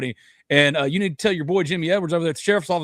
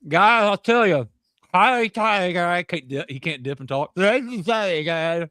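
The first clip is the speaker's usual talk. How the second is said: Brian Kelly impression; 2 semitones higher, slower than usual. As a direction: ham up the coach addressing his players in the meeting room. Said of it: "Or walk through the team meeting room going".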